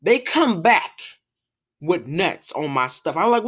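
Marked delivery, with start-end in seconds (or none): none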